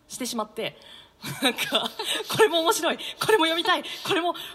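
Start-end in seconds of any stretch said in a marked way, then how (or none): 1.4-4.4 laughing, louder and higher, piling up the finds; bubbling over with excitement; giddy rush of enthusiasm; excited gushing. Real, not acted. Gushing about too many manga.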